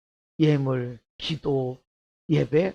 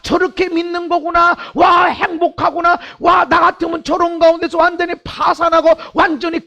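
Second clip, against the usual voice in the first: preaching at full force; 11 semitones higher, 9 dB louder than usual. Acted. Pastor voicing amazed onlookers, preaching at full force. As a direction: shout it to the congregation with wonder.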